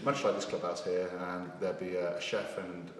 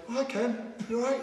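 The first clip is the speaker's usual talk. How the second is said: much higher mock cheerful voice; Tracy cheerfully. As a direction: play Tracy warmly greeting him. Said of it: "And she's like".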